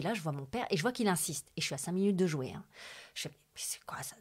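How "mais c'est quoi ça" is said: hushed surprise. Retold or imitated. Seeing her father's unusual call.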